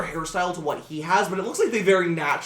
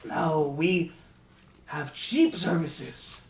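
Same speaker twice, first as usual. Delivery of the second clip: gentle reassurance; much slower than usual. Acted. Old goblin reassuring the broke player, slow and gentle. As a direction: ease their worry about money.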